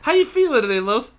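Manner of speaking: high, loud put-on greeting; mock interviewer question. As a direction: chirp the question in imitation. Acting out a question to co-host.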